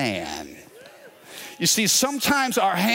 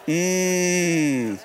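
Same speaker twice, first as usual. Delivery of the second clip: normal talking voice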